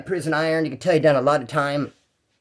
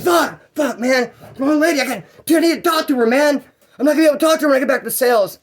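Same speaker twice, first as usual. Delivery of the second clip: higher, louder rant, rushed at the end; frustrated inmate rant; 8 semitones higher, 4 dB louder than usual. Retold or imitated. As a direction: complain desperately he can't reach her.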